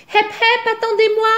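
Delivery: little frog hailing the birds as they take off; frog calling out. A narrator's reading. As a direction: shout to the departing birds, high and urgent. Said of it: "crie une grenouille".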